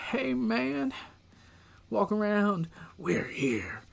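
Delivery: a hint of the eerie cult leader, ending in a whisper; lightly voiced creepy preacher, fading to a hush; imitating Bray Wyatt. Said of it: "go back to Bray Wyatt as just".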